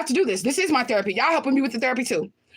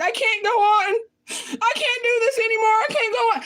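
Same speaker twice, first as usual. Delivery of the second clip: high-pitched pretend sobbing, hammed-up despair; mock crying; 9 semitones higher than usual. Imagining herself crying in the corner, mock sobbing. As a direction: wail it out like someone faking a breakdown. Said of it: "in the corner crying".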